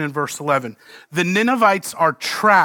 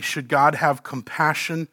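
ordinary talk

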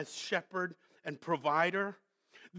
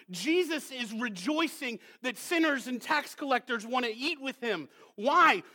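ordinary talk, nothing marked

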